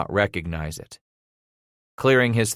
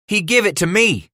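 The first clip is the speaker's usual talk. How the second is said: put-on high, loud boy's objection; protesting; 11 semitones higher, 5 dB louder than usual. A narrator's reading. Son claiming grandpap's gun from father, protesting. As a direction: insist it is yours, upset.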